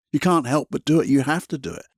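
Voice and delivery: usual speaking voice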